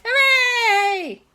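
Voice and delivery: very high, loud hooray; group cheer. Acted. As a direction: cheer like a crowd of happy kids. Everyone cheers for cake.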